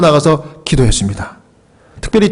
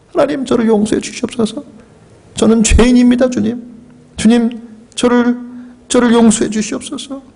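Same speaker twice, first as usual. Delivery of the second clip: higher, pleading voice of repentance; pleading prayer; 5 semitones higher than usual. Acted. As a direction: beg God for forgiveness, slowing on the last plea.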